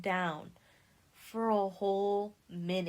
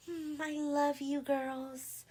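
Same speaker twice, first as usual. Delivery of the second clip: high, slow, drawn-out lovey imitation; mock gushing affection; 6 semitones higher, much slower than usual. Imitating Candace among her best friends, mock gushing. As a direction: coo it in a put-on higher pitch. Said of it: "Candace is being very, like".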